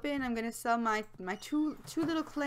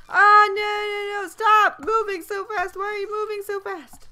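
high, loud cry at the game; panicked pleading; 9 semitones higher, 11 dB louder than usual. Real, not acted. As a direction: plead in rising alarm, starting loud. Fish moves too fast in game.

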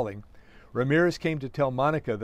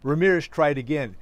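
plain reading voice

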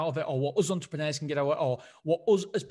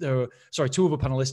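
ordinary talk

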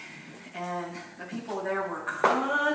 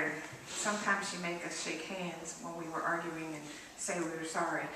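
ordinary talk

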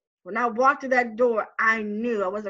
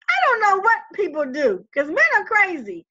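worked up; 7 semitones higher, 5 dB louder than usual. Retold or imitated. Arguing with cousin on phone.

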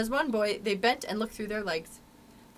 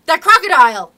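loud, high-pitched cry of alarm; alarmed gasp; 7 semitones higher, 17 dB louder than usual. A narrator's reading. Hook hears the ticking crocodile, alarmed gasp. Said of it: "he gasped".

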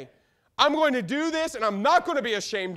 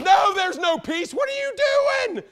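slow booming shout, much higher and louder; God's shout; 11 semitones higher, 5 dB louder, slower than usual. Retold or imitated. Preacher voicing God shouting at the false prophets. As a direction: thunder it out as God rebuking. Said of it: "God's saying".

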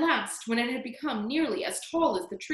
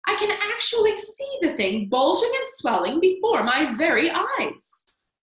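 high, loud, astonished cry; excited yell; 8 semitones higher, 5 dB louder than usual. A narrator's reading. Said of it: "Aunt Spiker yelled".

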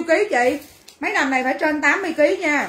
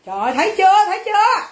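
louder, rising in pitch on the repeat; insistent, raised-voice "see?"; exasperated exclamation; 5 semitones higher, 4 dB louder, faster than usual. Real, not acted. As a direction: call it out, higher the second time. Exasperated repeat to teased customer.

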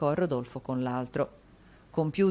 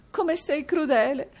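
much higher, slow, tearful plea; sobbing wife; 11 semitones higher, 5 dB louder than usual. A narrator's reading. Wife to husband, tearful plea. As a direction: beg him through mock tears.

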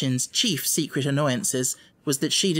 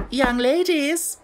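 much higher, slow and formal; dignified headmistress; 12 semitones higher, 4 dB louder, slower than usual. A narrator's reading. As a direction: call the class to order with dignity. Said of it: "in a dignified manner".

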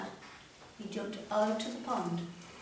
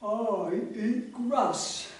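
very slow tortoise; 6 dB louder, much slower than usual. Acted. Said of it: "Tortoises talk like that".

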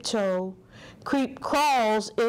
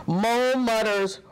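usual speaking voice

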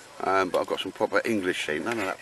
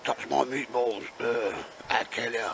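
slow, menacing mock mafia voice; a hammed-up Italian mobster threat; mafia boss; 3 semitones higher, 4 dB quieter, slower than usual. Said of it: "the old mafia bit coming out".

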